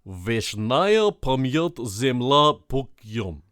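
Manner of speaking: put-on slow, loud Russian accent read; careful, loud Russian-accented reading. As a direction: sound out the Russian phrase slowly in a Russian accent. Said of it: "I can do a good Russian accent".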